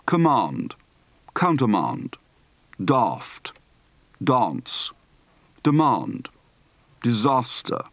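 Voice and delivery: long ah in "Command", "dance", "glass"; broad open-throated A. Shown as a demonstration. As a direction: read each word singly, "fast" as FAHST. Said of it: "Practice for the broad, open-throated A".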